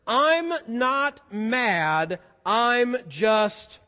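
very slow, word by word; drawn-out prompt. Shown as a demonstration. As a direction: stretch it out and leave the blank hanging. Drawn-out fill-in-the-blank prompt.

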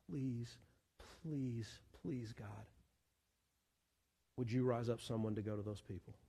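hushed, low, earnest plea; begging prayer. Acted. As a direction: plead very softly, with pauses between pleases. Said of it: "go to the Lord and say".

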